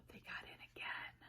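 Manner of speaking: gasped, breathless whisper of surprise; hushed gasp for the children; shocked whisper. A narrator's reading. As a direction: whisper it as if aghast. Gasp at bunnies getting in.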